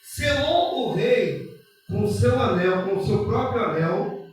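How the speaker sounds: loud, slow, sermon-style scripture reading; slow, loud preacher's Bible reading over music; preaching scripture. Acted. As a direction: proclaim the story to the congregation.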